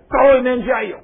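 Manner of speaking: loud, high command; angry order. Acted. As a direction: order it harshly. Creditor orders jailing.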